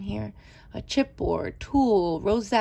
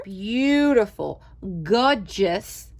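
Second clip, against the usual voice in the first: drawn-out, louder gushing praise; Crafting with Fury catchphrase; 7 dB louder, much slower than usual. Retold or imitated. Imitating a crafter's catchphrase to viewers, loud slow gushing. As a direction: stretch it out slowly and gush. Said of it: "like Crafting with Fury says".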